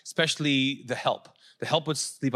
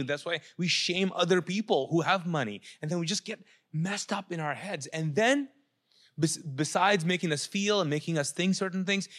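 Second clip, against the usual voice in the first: normal talking voice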